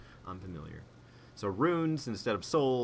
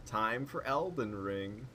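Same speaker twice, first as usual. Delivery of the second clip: ordinary talk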